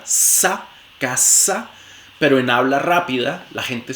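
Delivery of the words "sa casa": "casa" with a crisp hissed s; voiceless s in casa. Shown as a demonstration. Teacher modelling casa with a voiceless s. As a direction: hiss the s of "casa" sharply. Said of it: "que se realiza sorda".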